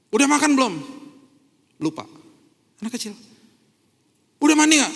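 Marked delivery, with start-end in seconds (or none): 0.1-0.7 nagging parent. Retold or imitated. Parent asking a child. 4.4-4.9 loud, high nagging question; nagging parent. Retold or imitated. Parent nagging a child to take a bath, loud and high.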